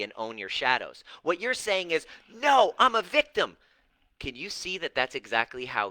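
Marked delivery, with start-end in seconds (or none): 2.3-3.6 loud, high-pitched mock outburst; defiant protest. Coach mimics a client refusing responsibility, defiant and loud. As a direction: dig in with an indignant, raised-voice denial.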